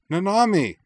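loud high anguished cry. A narrator's reading. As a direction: cry her name in alarm. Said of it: "Anthy cried".

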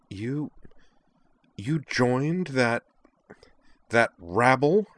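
high, halting disbelief that builds in volume; sputtering outrage. A narrator's reading. Liza learning Sky joined the duelists, sputtering outrage. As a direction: stumble over the words, appalled. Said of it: "Liza sputtered".

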